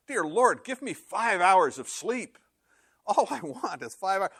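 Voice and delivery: pleading. Acted. Sleepless parent prays for five hours of sleep, pleading. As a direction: beg dramatically for sleep.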